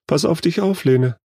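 high-pitched anxious motherly call; worried mother. A narrator's reading. Mother sees her daughter off to school, worried. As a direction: voice a mother's concern. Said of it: "die sorgenvolle Stimme der Mutter".

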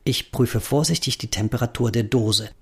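ordinary delivery, nothing marked

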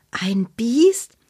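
unvoiced, breathless alarmed question; frightened whisper. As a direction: gasp it out in a scared whisper. Paula frightened hearing of beast.

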